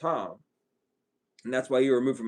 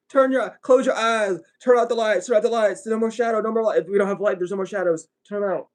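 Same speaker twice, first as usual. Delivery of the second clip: higher, louder mock urgency; mock frantic instructions; 6 semitones higher, 4 dB louder than usual. Mocking believers who want lights off, raised mock urgency. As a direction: hurry them like a panicked believer.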